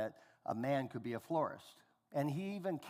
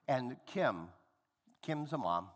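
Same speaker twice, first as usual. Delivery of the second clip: normal talking voice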